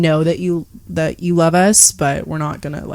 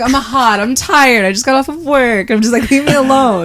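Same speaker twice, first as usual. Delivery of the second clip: higher, irritated complaint; annoyed brush-off; 5 semitones higher than usual. Retold or imitated. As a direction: push him away, fed up. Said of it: "I'm like".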